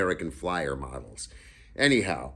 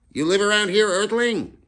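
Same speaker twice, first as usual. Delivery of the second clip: much higher, much louder piping voice; a tiny kid demanding an answer; Freak's question; 9 dB louder than usual. Acted. Freak asks the big kid where he lives, much higher and louder.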